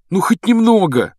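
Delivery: high, loud begging; eager pleading. Acted. Boy begging his friend for the story, eagerly pleading. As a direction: press him for just a bit.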